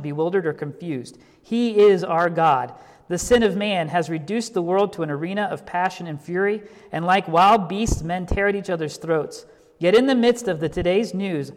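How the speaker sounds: a pastor proclaiming God's rule over history; preaching at full force. Acted. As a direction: proclaim it with conviction. Preaches Barnhouse's answer.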